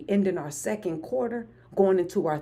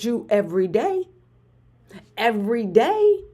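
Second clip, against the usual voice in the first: hype answer; 5 semitones higher, 6 dB louder than usual. Retold or imitated. Answering her brother-in-law about her morning hype, loud and proud. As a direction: shout it out proudly, stretched. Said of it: "I said".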